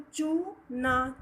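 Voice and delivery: syllables stretched very slowly; teacher sounding out a word. Shown as a demonstration. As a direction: spell out चूना slowly, sound by sound. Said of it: "च को ऊ".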